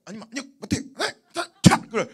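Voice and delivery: loud, abrupt mock refusal; blunt Gyeongsang refusal. Gyeongsang person refusing to someone's face, blunt. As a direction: blurt it out bluntly, hamming it up. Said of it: "경상도는 직설적이에요".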